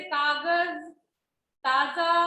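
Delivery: कागज and ताजा said slowly with z; teacher modelling z words slowly; slow nukta demo. Shown as a demonstration. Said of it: "इनका उच्चारण हम क्या करेंगे".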